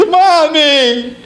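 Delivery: put-on high-pitched child's wail for mother; little boy calling mom. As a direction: call out like a whiny spoiled kid. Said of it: "I went to my mother".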